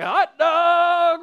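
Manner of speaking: high, loud, delighted cry; a kid's eager yelp; excited kid. Retold or imitated. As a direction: spot the hot dog stand and light up. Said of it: "He's like".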